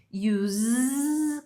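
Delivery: long buzzing Z ending "use"; voiced final Z on "use". Shown as a demonstration. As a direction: draw out the Z in "use". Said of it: "com esse sonzinho de Z no final".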